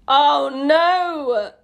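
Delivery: loud, high wail of dismay; horrified cry. Real, not acted. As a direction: cry out in cringing dread. Horrified at next line.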